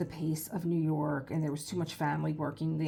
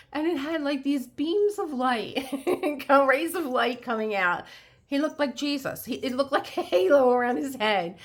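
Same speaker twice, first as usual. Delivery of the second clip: high excited gushing; starstruck, voice climbing with awe; swooning; 7 semitones higher, 6 dB louder than usual. Real, not acted. Swooning over Leo's beauty.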